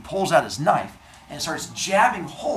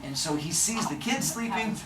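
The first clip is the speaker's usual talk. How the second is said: ordinary delivery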